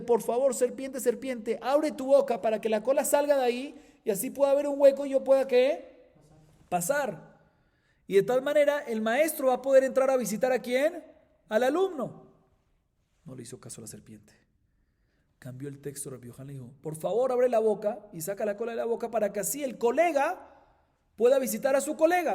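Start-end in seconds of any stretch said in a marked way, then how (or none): 0.0-12.3 high, loud imploring call to the snake; pleading with serpent. Acted. 16.8-22.3 high, loud imploring call to the snake; pleading with serpent. Acted. Second plea to serpent.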